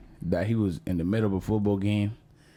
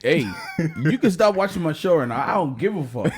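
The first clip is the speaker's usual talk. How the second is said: loud, raised-pitch dismissal; indignant rant; 7 semitones higher, 6 dB louder than usual. Real, not acted. Host dismissing viewers who object, indignant. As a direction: tell them off at raised volume.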